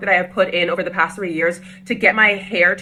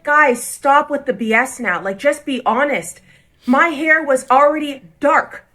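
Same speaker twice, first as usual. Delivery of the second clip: louder, slow and higher, pressing each point; exasperated confrontation; 6 semitones higher, 4 dB louder, slower than usual. Retold or imitated. Standing up to the salon staff, fed-up and insistent. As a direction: push back hard at the stylist who is lying.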